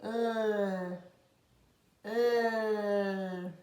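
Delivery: L as a long held "lll"; isolated L sound. Shown as a demonstration. Teacher introducing the letter L, its sound held long. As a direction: sustain the tongue-tip "lll", no letter name. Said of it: "O próximo é a letra L".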